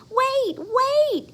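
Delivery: high, loud call after the bus; yelling. Acted. Froggy yelling at the leaving bus, high call. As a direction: shout after it in a rush. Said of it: "he yelled".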